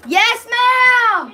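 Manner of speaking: high, drawn-out answering shout; real loud call. Real, not acted. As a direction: shout back to someone calling you. Answers someone calling from elsewhere.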